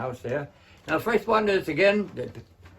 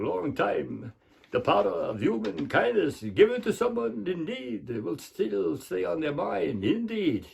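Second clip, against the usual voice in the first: Bela Lugosi. Acted. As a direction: savour each word in a Hungarian Dracula accent. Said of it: "Bela Lugosi or Count Dracula".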